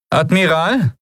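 high-pitched call to a superior; questioning address. A narrator's reading. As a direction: address the officer, pitched well up. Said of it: "wiederholte Aran erneut".

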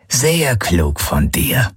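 slow grumbling brute; drawn-out rumble of approval. A narrator's reading. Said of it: "brummte Knochenfresse".

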